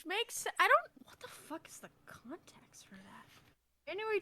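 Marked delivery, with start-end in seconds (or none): 1.1-3.3 baffled whisper; whispered aside. Real, not acted. Reading her own old note, whispering in disbelief. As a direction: hush it to yourself in disbelief.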